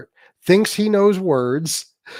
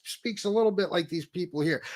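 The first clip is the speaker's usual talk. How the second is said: ordinary talk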